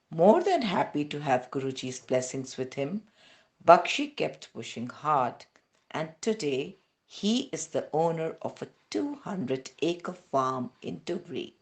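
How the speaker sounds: normal talking voice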